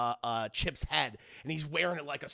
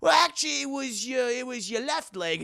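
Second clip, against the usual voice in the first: put-on cabin boy; 6 semitones higher, 5 dB louder than usual. Old cabin boy corrects captain.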